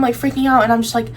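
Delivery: ordinary delivery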